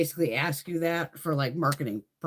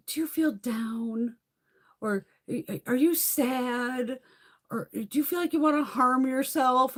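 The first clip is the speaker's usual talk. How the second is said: mock receptionist; 8 semitones higher, 3 dB louder, slower than usual. Mocking the receptionist's questions.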